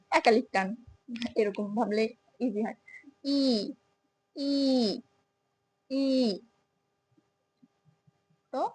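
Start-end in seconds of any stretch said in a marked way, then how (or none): 3.2-6.1 long stretched ee sound. Shown as a demonstration. Teacher modelling a long ই for students, drawn out. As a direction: hold the ee for a full second. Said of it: "এটা একটু টান হবে".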